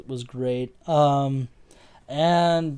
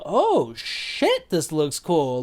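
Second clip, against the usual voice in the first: pitched-up mock awe; impressed reaction; much slower than usual. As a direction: gasp at how cool it looks. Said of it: "I was like".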